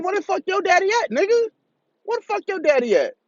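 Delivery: exasperated rant. Retold or imitated. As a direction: demand it high, in disbelief. Ranting at brother about absent dad.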